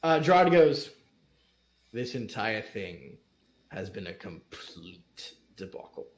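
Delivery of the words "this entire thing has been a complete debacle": low, slow and very soft; glum muttered verdict. Acted. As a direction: let the sentence sink to almost nothing. Gerard laments the ceremony.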